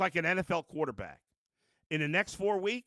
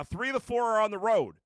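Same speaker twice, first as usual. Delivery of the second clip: ordinary talk, nothing marked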